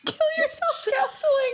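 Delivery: high laughing through the words. Real, not acted. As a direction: laugh out the name. Laughing at dark joke.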